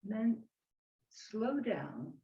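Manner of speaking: serene tai chi cues. Acted. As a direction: guide the movement gently.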